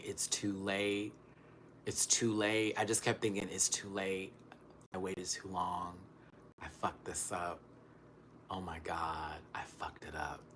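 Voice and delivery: very quiet, sinking inner voice; hushed, acted-out regret; resigned despair. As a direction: murmur it to yourself, fading almost to a whisper. Acts out despairing sick thoughts.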